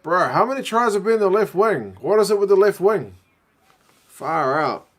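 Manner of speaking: loud, high-pitched disbelief at the match; exasperated outburst. Real, not acted. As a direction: cry out like a fed-up rugby fan. Exasperated by repeated tries conceded.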